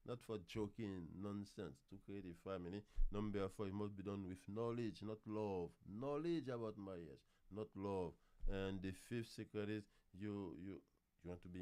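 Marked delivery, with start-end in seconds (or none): none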